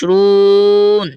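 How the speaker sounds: slow, drawn-out recitation; Quran recitation. Shown as a demonstration. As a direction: chant the word slowly. (ধীর তিলাওয়াতের সুরে)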